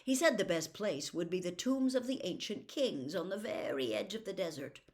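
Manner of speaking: ordinary delivery, nothing marked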